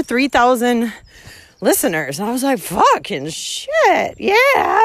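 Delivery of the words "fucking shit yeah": slow then a high shouted yeah; stunned excited yell. Retold or imitated. Thrilled at three thousand listeners, slow disbelief into a high shout. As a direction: let the number hit, then whoop.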